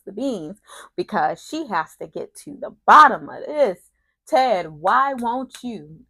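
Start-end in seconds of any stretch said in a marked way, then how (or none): 4.3-6.0 slow, raised, louder plea; hammed-up drawn-out appeal; mock pleading. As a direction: mimic a pleading woman, dragging it out. Mimics Leslie pleading with Ted.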